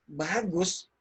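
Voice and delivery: unenthusiastic, drawn-out "bagus"; insincere praise. Shown as a demonstration. Coach compares intonations of one word, insincere praise. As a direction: say good without meaning it. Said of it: "Karena intonasinya".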